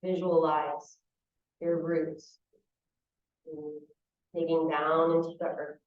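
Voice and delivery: calm visualization in short, spaced phrases; unhurried meditation teacher, phrase by phrase; guided meditation. Real, not acted. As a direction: guide them through the image, leaving long silences.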